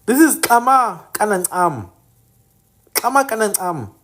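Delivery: Kama Kanancam with a clear click, stress on Kama; native pronunciation. Shown as a demonstration. Teaching viewers the original name, carefully pronounced. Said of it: "This is not Rafir Sonarand".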